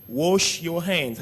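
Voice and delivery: slow "Wash your hands" in English; English phrase. Shown as a demonstration. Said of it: "on dit". ("Wash your hands" articulé lentement)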